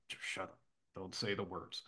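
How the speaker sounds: whispered warning to himself; inner whisper. Retold or imitated. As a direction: hiss it under your breath to yourself. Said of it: "I'm like".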